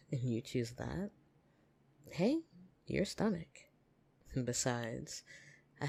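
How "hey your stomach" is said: mock-scolding hey over the pet's food; surprised hey. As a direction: catch them off guard with a sharp hey. Scolding the listener's food choice.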